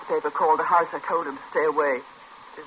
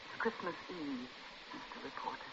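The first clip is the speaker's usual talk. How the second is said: hushed rebuke, much softer; bitter hush; 2 semitones lower, 19 dB quieter than usual. Acted. Mother rebukes intrusive reporter.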